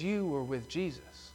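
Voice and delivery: slow, soft, leaning on YOU; single him out with "You"; slow and quiet, the word "You" pressed hard; pointed accusation. Retold or imitated. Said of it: "No, she says".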